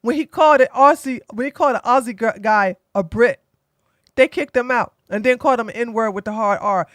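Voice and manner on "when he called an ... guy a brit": usual speaking voice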